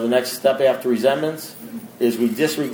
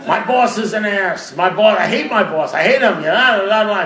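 raised, whiny mock rant about the boss, higher; mock complaining; 6 semitones higher than usual. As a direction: mimic a griping newcomer, half laughing.

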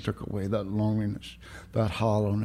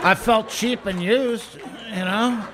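high, slow mock-wounded whine; mock hurt; 11 semitones higher, 4 dB louder, slower than usual. Recovering drunk joking to the room, mock wounded whine. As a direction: play the hurt victim for laughs. Mock self-pity at sponsor's lesson.

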